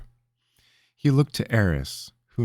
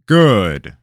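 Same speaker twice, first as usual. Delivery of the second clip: cooing; 3 semitones higher, 10 dB louder than usual. A narrator's reading. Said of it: "the king cooed".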